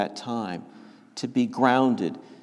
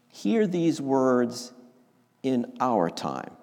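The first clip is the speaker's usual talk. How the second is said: slow; slower than usual. Shown as a demonstration. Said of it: "I'll read it slowly".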